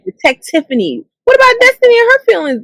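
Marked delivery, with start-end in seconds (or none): 1.2-2.6 pitched way up and quick, fed up; indignant rising protest; exasperated outburst. Real, not acted. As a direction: demand it, voice jumping high. Exasperated defense of Destiny.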